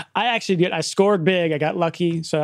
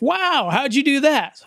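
impressed English property manager; 10 semitones higher, 3 dB louder, slower than usual. Retold or imitated. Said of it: "He's like".

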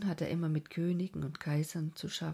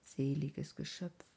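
usual speaking voice

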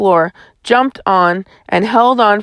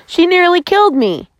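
high-pitched indignant complaint; sputtering indignation; 10 semitones higher than usual. A narrator's reading. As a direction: protest the attack in a high voice. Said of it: "he sputtered".

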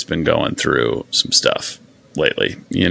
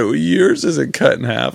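imitating the toddler; 6 semitones higher than usual. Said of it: "that's exactly what he said".

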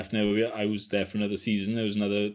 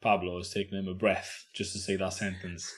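ordinary delivery

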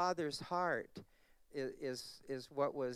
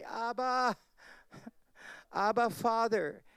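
rising, heartfelt call of Abba, louder and higher; crying Abba Father; 6 semitones higher, 6 dB louder than usual. Acted. Preacher calling out Abba Father to God.

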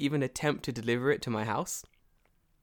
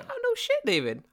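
sudden much higher, louder protest; laughing outburst; 4 dB louder than usual. Real, not acted. Laughing protest at the cohost's jab, high and loud. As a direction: blurt it out, half laughing.

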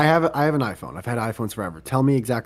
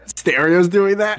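amused disbelief; 7 semitones higher, 6 dB louder, slower than usual. Real, not acted.

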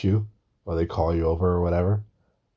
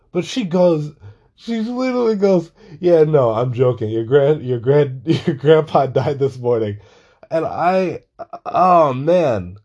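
laughing; 7 semitones higher, 6 dB louder than usual. Real, not acted. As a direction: quote mom high and loud, cracking up. Laughing while quoting mom.

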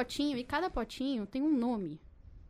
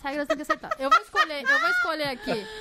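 excited; 13 semitones higher, 5 dB louder than usual. Real, not acted. Host playfully announces the draw.

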